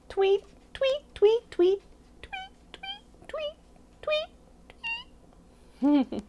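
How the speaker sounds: high-pitched chirps fading away; repeated bird tweets. A narrator's reading. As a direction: chirp like a little bird, trailing into softness. Imitates the pig's new bird friends.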